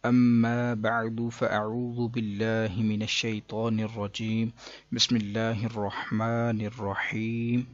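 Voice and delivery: slow, measured Arabic invocation; formal recitation. Acted. Teacher opening the class with a slow recited blessing. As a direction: intone the opening blessing with care.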